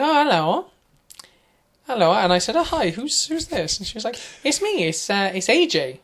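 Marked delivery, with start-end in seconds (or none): 0.0-2.1 high, thin, reedy woman's voice. Retold or imitated. Imitating a woman's reedy voice on the phone. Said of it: "reedy kind of voice". 2.5-3.8 mock high hello. Retold phone call. 4.4-5.9 high, thin, reedy woman's voice; reedy caller. Retold or imitated. Imitating a woman announcing herself on the phone.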